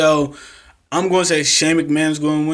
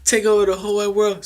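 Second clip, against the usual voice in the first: higher, put-on buddy voice for Miz; a light mock of an eager partner; mock Miz pitch; 6 semitones higher, 3 dB quieter than usual. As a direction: pitch it up, sweet-talking a partner. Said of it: "when he was like".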